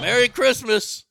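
loud drawn-out greeting. Retold or imitated. Movie quote, cheery greeting.

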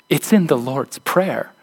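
ordinary talk

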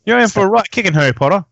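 playful Cockney threat; mock Cockney. Shown as a demonstration. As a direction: ham up a Cockney tough. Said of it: "use our messed up English accents".

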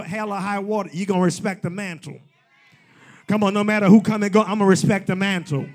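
loud, driving preacher cadence, pushing each line; preaching at full force. Acted. Preacher driving home respect for the mantle, full-force preaching. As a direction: hammer the congregation with it.

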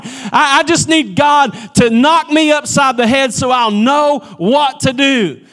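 loud, high, slow preaching climax; heated sermon peak, loud; preaching at full force. Real, not acted. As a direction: drive it home to the congregation.

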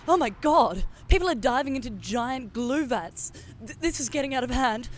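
loud, fast, frantic report; panicked reporter. Acted. Reporter amid riot panics.

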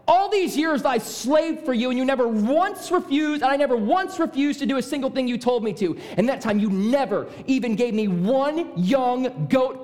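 high, heated tirade; very angry. Acted. Older brother raging at his father to prove a point, very angry. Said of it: "as angry as the second time".

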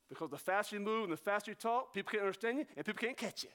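words tumbling out in a quick rush; rapid talk. Shown as a demonstration. Preacher showing how people talk too fast to follow, rapid. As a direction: rattle it off fast.

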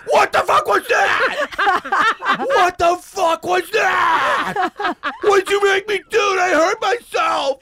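high, loud, slow panicked shouting; freaked-out yell. Pretend. Man freaking out in bed, shouting slowly in mock panic. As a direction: shout it out in horrified confusion. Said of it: "I'll get really freaked out".

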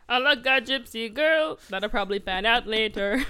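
lightly put-on Jamaican accent, half-sung. Voicing Phoebus's song for laughs, mock Jamaican accent. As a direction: parody him with a makeshift Jamaican accent. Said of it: "I don't know why I made him Jamaican".

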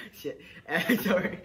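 embarrassed laugh. Real, not acted. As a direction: laugh through the apology. Laughs at own slip.

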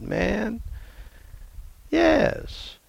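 ordinary delivery

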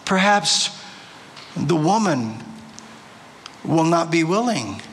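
deliberate reading with long pauses and sweeping pitch; scripture reading. Real, not acted. Preacher reading Genesis aloud to the congregation, measured and dramatic. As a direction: read it out measured, lifting key phrases.

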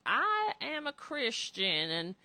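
mock Southern drawl, slow and high at the start; stretched-out Southern imitation; Southern accent. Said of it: "they had a Southern accent".

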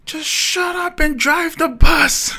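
put-on high, loud, slow shout of a tiny old lady; lady snapping at driver. Passenger yelling at chatty driver: high slow shout. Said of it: "the small Japanese lady".

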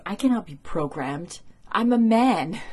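hammed-up macho soldier voice, rising high on man; lightly mocking a stiff tough guy; mock Riley. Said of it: "Riley says".

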